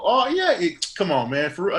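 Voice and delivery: high and loud; cocky boast. Retold or imitated. Telling the barber he's for real, high and loud. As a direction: brag with full confidence.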